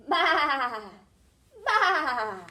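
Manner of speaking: loud, wavering baa; sheep bleat imitation. Shown as a demonstration. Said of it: "A sheep says".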